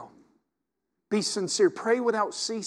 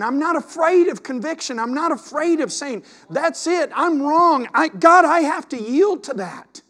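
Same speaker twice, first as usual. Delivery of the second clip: loud, high, climbing preacher's cry; fervent pulpit shout, voice lifting on each line; preaching at full force; 8 semitones higher, 8 dB louder than usual. Real, not acted.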